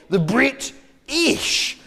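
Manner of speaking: "Brit-ish" drawn out slowly, split before "ish"; split word. Shown as a demonstration. Comedian explaining how we got our name, "Brit-ish" split slowly in two. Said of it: "is how we got our name".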